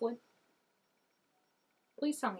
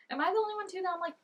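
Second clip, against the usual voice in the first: high excited suggestion; 8 semitones higher, faster than usual. Real, not acted. Excited plea to viewers.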